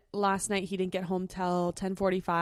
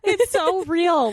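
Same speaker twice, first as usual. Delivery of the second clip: emphatic gush; 9 semitones higher, 8 dB louder, much slower than usual. Real, not acted. Emphatic gushing about husband.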